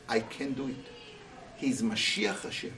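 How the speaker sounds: low, soft, inward and certain; quiet resolve. Retold or imitated. David answers his men in a low, soft voice. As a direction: say it to yourself, quietly.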